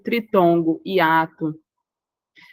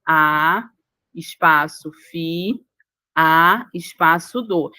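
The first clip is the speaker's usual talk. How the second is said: slow a, fi, a, do with "espaço" between; syllable by syllable; 2 semitones lower, much slower than usual. Shown as a demonstration. Teacher dictates the separation of afiado, syllable by syllable. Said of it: "quando eu faço a separação".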